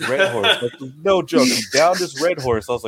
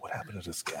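hushed; 4 semitones lower, 17 dB quieter than usual. Retold or imitated. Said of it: "It's like".

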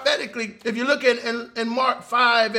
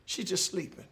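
soft reassurance; 6 semitones lower, 14 dB quieter than usual. Acted. Jesus reassures the father.

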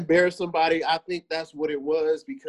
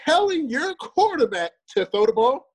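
high, drawn-out disbelief; incredulous challenge; 5 semitones higher than usual. Real, not acted. Co-host calling out a hypocritical Patriots fan, incredulous. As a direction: stretch the words in pitched-up disbelief.

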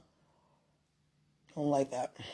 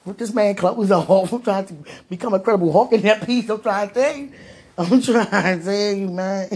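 higher, louder giddy outburst with laughs; worked up, laughing; 5 semitones higher, 5 dB louder than usual. Real, not acted. Joking about ripping this man's clothes off, worked up. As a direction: get carried away and laugh through it.

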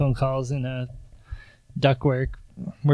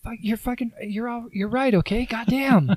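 high, exasperated, grudging give-in; exasperated admission; 9 semitones higher than usual. Retold or imitated. Hero told to say it louder, exasperated and grudging. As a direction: blurt it out annoyed in a strained voice. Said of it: "Say it louder".

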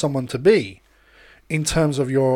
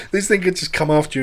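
ordinary talk

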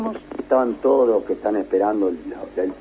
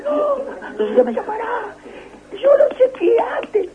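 much higher female voice, pleading; imitating Rosita; slower than usual. Mimicking telephonist Rosita warning him, high pitched imitation. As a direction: squeak out her warning.

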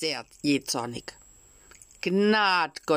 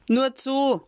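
loud, high call to the door; 10 semitones higher, 5 dB louder than usual. Acted. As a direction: shout through the door. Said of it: "rief der Schreiner".

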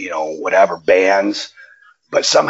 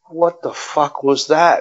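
higher-pitched, drawn-out mock amazement; imitating someone dumbfounded, pitched up and slow; stunned disbelief; 7 semitones higher, slower than usual. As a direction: retell a buddy's slow, incredulous reaction. Retold stunned reaction to karaoke performance.

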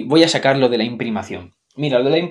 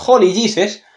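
high, louder Holy Jesus; a startled, raised Holy Jesus; shocked exclamation; 7 semitones higher, 6 dB louder than usual. Retold or imitated. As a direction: blurt it out in shock.

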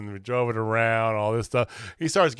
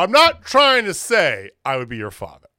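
louder, higher mock protest; imitated promo protest; 5 semitones higher, 6 dB louder than usual. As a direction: act out a wrestler's emphatic denial. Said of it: "immediately he's like".

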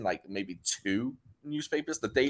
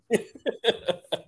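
high burst of real laughter; a sudden high laugh at the joke; 12 semitones higher than usual. Real, not acted. Laughs at the story.

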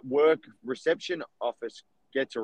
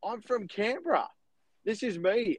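eager reply; 7 semitones higher than usual. Retold or imitated. Reenacting reply on the phone.

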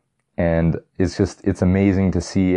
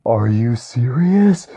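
slow, drawn-out disbelieving question; can't believe the villain is back; stunned disbelief; 5 semitones higher, 4 dB louder, slower than usual. Retold or imitated. Fan reacting to Red Skull's return, stunned disbelief. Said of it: "I was like".

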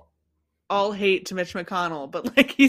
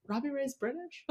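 baffled; 4 semitones higher, 11 dB quieter than usual. Retold or imitated. Retelling own baffled reaction.

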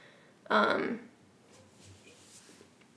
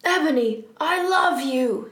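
sad shout; 4 semitones higher, 5 dB louder than usual. Acted. Draco declaring love to Ebony, theatrical sad shout. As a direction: cry it out in hammed-up heartbreak. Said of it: "he shouted sadly".

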